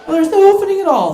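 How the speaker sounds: high, loud and fast frustrated yell; a man's mock shout of disbelief; exasperated shout. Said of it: "I shouted too much".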